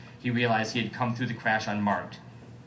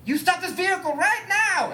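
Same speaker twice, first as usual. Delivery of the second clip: high, much higher hammed-up roar; roaring command; 4 dB louder than usual. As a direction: yell it at the driver in a high comic voice. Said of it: "Elliot roared".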